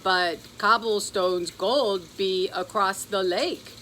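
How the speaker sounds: slow, odd leprechaun voice with a scowl. A narrator's reading. The leprechaun objecting to Pearl, slow scowling. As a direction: grumble it out, sullen. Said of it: "he said with a scowl".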